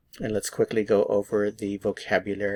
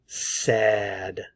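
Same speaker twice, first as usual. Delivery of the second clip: normal talking voice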